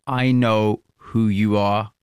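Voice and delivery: slow, low and deliberate; ominous warning. Retold or imitated. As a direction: lay it down like a threat. Lawyer confronting them, menacing line.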